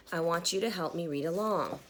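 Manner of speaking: ordinary delivery, nothing marked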